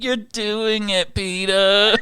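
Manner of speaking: high, slow Lois voice with a laugh; Lois impression. Wife praising a good job in a high Lois voice. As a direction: coo it like Lois. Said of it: "[name] kills Lois".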